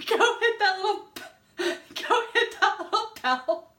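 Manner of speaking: giggling through the words; laughing. Real, not acted. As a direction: laugh at your own slip. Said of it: "Belly button".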